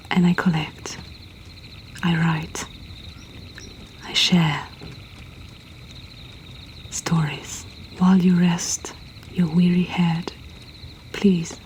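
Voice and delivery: usual speaking voice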